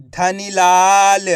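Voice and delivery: धनीलाल slow, loud long laa; blended word demo. Shown as a demonstration. Teacher reading for pupils to repeat, धनीलाल stretched. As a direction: draw out the लाल of धनीलाल. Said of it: "आपको मेरे साथ साथ repeat करना है".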